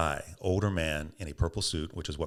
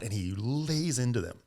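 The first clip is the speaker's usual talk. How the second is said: normal talking voice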